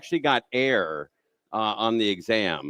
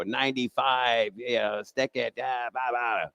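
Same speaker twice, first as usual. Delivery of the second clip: slow, softer smug gloat; taunting teen; 5 dB quieter, slower than usual. Retold or imitated. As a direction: rub it in the examiner's face. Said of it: "I go".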